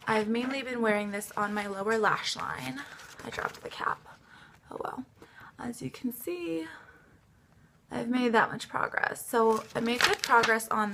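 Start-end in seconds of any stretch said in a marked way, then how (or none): 3.3-5.1 whispered aside. Real, not acted. Aside while filming, cap falls. 5.6-6.7 whispered aside. Real, not acted. Quiet aside to camera.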